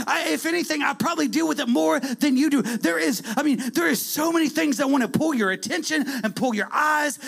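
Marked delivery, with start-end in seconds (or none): 0.1-4.5 raised, higher preaching voice. Acted. Preacher to congregation, rising to full force. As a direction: press it home to the church.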